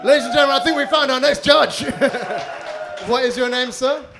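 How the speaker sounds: loud, high showman hype; announcer voice. Real, not acted. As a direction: announce it to the whole room. Host announcing to crowd.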